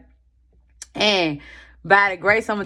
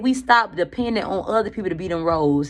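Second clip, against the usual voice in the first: usual speaking voice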